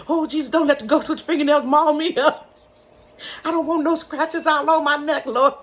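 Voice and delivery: put-on high, loud, frantic child's prayer; frightened child praying the ghost away with her mother. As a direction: pray desperately like a scared kid.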